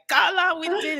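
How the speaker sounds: put-on high Cameroonian accent; excited Cameroonian voice. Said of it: "reading it in my head in Gilles' voice".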